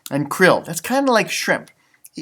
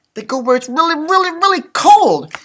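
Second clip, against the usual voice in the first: emphatic; 10 semitones higher, 5 dB louder than usual. Real, not acted. Emphatic for children.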